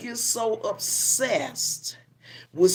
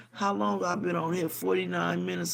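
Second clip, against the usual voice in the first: usual speaking voice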